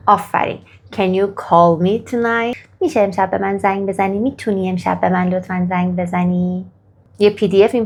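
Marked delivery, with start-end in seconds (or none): 0.9-2.6 very slow, each word spaced out for learners; slow model question. Shown as a demonstration. Teacher modelling the question very slowly for students. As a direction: ask it slowly so students can repeat. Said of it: "حالا شما اینو به من بگید".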